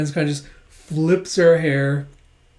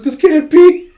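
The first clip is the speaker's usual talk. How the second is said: much higher and louder, aghast; horrified gasp; 12 semitones higher, 12 dB louder than usual. Retold or imitated. Yor seeing Becky fall into Lloyd, horrified cry. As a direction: recoil in horror.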